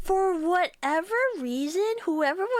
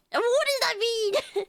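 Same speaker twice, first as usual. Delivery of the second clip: high, slow laughing question; 8 semitones higher, slower than usual. Real, not acted. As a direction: giggle through the question. Laughing at chat comment.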